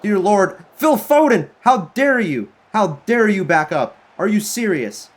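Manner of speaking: real furious shout, pitch way up; furious outburst. Real, not acted. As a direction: scold him for backing off, voice cracking up high. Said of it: "I'm, I'm furious here".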